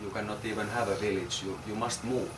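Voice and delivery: ordinary talk, nothing marked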